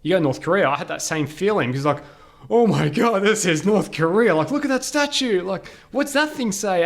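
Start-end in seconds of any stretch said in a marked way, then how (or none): 2.5-6.8 higher-pitched, wide-eyed tourist awe; excited re-enactment of being amazed; retold amazement. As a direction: gawp at everything, voice lifted high. Retold awe on arriving in North Korea.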